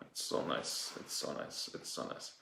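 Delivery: whispered and fading away. Pretend. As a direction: let it drift off into a whisper. Dreamy repeat fading away.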